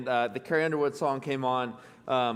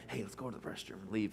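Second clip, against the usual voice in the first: much softer, lower, a hushed aside; mock sneaky aside; 6 semitones lower, 14 dB quieter than usual. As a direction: play the listener plotting to slip out. Mocking audience wanting to sneak out.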